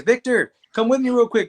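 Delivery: hey, get over here quick; eager call. Retold or imitated.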